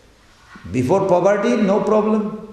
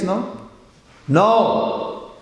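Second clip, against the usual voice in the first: usual speaking voice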